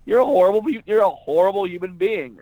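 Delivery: high, loud mock-nasty retort; playfully vicious comeback; retold insult. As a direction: snap back at the heckler. Retold heckler comeback onstage.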